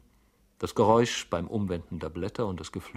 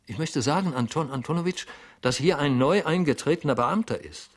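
agitated Goljetkin; 8 semitones higher, 6 dB louder than usual. A narrator's reading. Flustered clerk explains.

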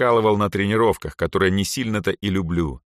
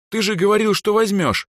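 very high-pitched protest; indignant; 4 dB louder than usual. A narrator's reading. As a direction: protest in outrage at a broken promise. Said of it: "возмутилась Эльза".

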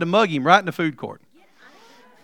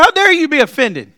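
higher, louder rebuke; God rebuking; 6 semitones higher, 4 dB louder, slower than usual. Retold or imitated. God rebuking the preacher's resentment, higher and louder. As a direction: press every how dare you. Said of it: "He said".

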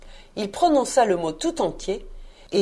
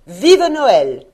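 loud, raised festive exclamation; rousing cheer; 4 semitones higher, 9 dB louder than usual. A narrator's reading. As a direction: cheer it out heartily. Neveu acclame Noël avec ardeur. (acclamation vibrante)

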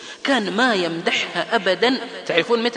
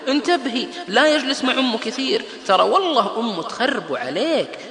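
higher, louder meddling neighbour; gossiping woman; 7 semitones higher, 4 dB louder than usual. Retold or imitated. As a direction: play the scheming friend. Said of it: "تجي واحدة لزميلتها".

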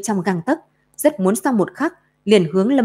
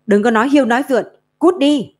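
angry shout; 5 semitones higher, 4 dB louder, faster than usual. Acted. Enraged girl drives off shadow.

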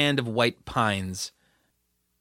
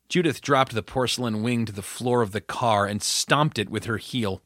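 usual speaking voice

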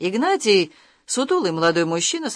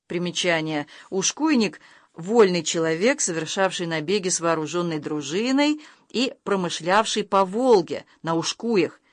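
ordinary delivery, nothing marked

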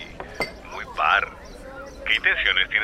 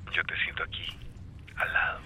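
breathy close whisper; intimate whisper; 9 dB quieter than usual. Acted. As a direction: whisper it as if right beside her. Intimate flirting on the phone.

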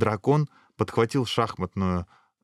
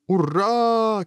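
high, sustained hurrah; crowd's cheer; 13 semitones higher, 3 dB louder than usual. A narrator's reading. Crowd carrying off the hero, high cheering. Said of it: "кричала толпа".

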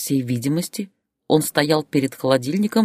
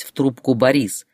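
ordinary delivery, nothing marked

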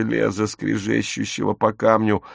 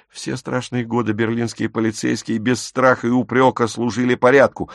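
ordinary delivery

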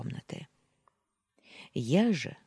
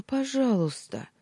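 pitched up, breaking plea, falling; desperate sob; 9 semitones higher than usual. A narrator's reading. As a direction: beg harder, sobbing. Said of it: "рыдая навзрыд". (отчаянно, навзрыд)

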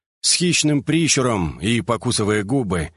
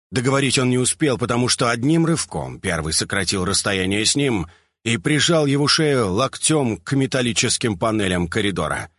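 ordinary delivery

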